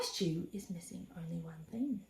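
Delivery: sly unvoiced whisper. A narrator's reading. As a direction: whisper the plot slyly. Sly dingo plotting.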